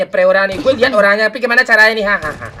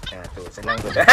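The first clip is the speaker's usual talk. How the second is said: ordinary talk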